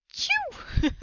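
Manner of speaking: big high hammed-up sneeze; mock sneeze. Retelling how Trevor sneezed his glasses off, exaggerated sneeze. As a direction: blast out a cartoon sneeze. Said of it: "he had sneezed so hard".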